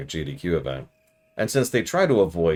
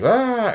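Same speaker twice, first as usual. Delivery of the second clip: high, loud, held "ah"; imitated drowning-out yell; 7 dB louder than usual. Covering Irons' cussing at the event, a high loud mock yell. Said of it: "the Guardians kept being like".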